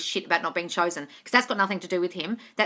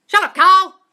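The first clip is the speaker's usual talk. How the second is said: much louder, higher full yell; yelled telling off; 9 semitones higher, 12 dB louder, slower than usual. Shown as a demonstration. Delighted by a viewer's comment, screaming the line out. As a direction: blast the critic with a screaming shut-up. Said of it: "Karen and Carl, I love it".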